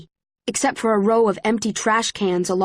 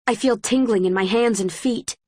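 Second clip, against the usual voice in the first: ordinary delivery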